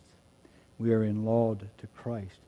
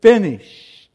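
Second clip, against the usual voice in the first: loud, high cry of it is finished; triumphant shout; 10 semitones higher, 17 dB louder, much slower than usual. Retold or imitated. Preacher shouting Jesus' last words to the congregation, loud. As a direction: cry it out in victory. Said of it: "he shouts".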